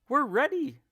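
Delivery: high, loud eager reply; eager monkeys. A narrator's reading. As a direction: answer like excited little monkeys. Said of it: "said the monkeys".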